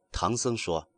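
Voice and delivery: plain reading voice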